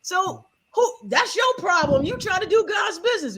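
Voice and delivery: high mock shout of granny. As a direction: yell it at her like granny would. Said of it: "she said so".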